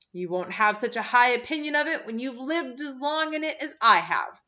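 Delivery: sour retort. A narrator's reading. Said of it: "retorted Miss Eliza sourly".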